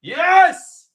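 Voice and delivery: high, loud cheer; triumphant yell. Real, not acted. Fan celebrating South Africa's goal, yelling in triumph.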